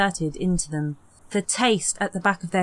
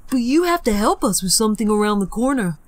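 fast, louder coaxing; quick bait; 3 semitones higher, 4 dB louder, faster than usual. Acted. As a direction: rush the trap past her. Peter baiting.

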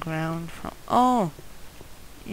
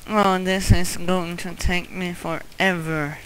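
ordinary talk

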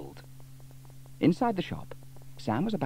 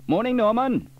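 cheery Sam; 13 semitones higher, 8 dB louder than usual. A narrator's reading. Sam greets Norman.

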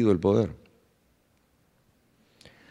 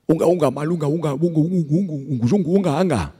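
a higher caveman chant of unga unga; lightly voiced caveman babble; caveman grunting; 3 semitones higher than usual. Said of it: "Y Trucutru dijo".

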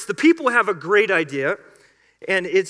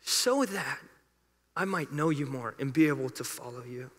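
hushed plea; 7 semitones lower, 10 dB quieter than usual. Acted. Moses' prayer ends hushed.

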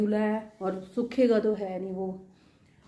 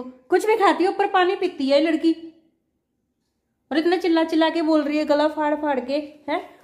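scolding; 6 semitones higher, 7 dB louder than usual. Real, not acted. Mother scolding coughing daughter.

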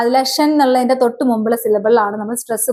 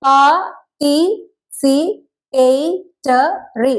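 "Pa, ti, si, pe, te, ri" as separate beats, a pause after each; syllable by syllable; much slower than usual. Shown as a demonstration. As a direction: count out "Pa, ti, si, pe, te, ri" one isolated syllable at a time. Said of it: "എത്ര syllable വരുന്നുണ്ട്? നമുക്കൊന്ന് just check". ("Pa, ti, si, pe, te, ri" ഓരോ സിലബിളായി നിർത്തി നിർത്തി)

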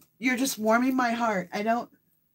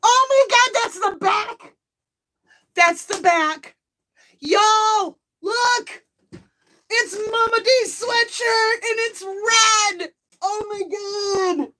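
real high, loud burst of amazement; overjoyed shout; 10 semitones higher, 5 dB louder, much slower than usual. Real, not acted. Birthday host showing off the new sweatshirt, overjoyed. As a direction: cry out in delight, pitched way up.

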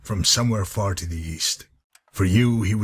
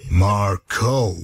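"Marco" split and louder; pointed correction; 5 dB louder than usual. Acted. Correcting the companion mid-answer, pointed and deliberate. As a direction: push out "Marco" as two separate syllables. Correcting the misheard name again.

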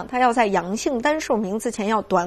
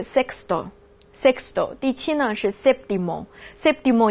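西语序数词sexto一字一顿慢慢读; 放慢语速把sexto读清楚给学生听; 慢读示范; slower than usual. Shown as a demonstration. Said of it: "可以给大家从第一介绍到第十哈". (教学示范式的慢速朗读)

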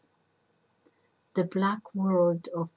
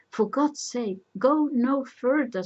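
higher, louder entreaty; urgent plea; 6 semitones higher, 5 dB louder than usual. A narrator's reading. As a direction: beg him to stop, voice raised. Jocasta begs him to stop asking.

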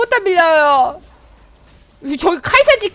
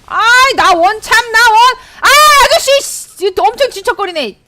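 exasperated outburst; 8 semitones higher, 10 dB louder than usual. Real, not acted.

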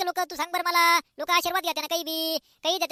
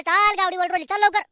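calling out; 2 semitones higher, 9 dB louder than usual. Acted. Father calling Mithya to hurry because the cow is pulling. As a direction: hurry him along with a shout.

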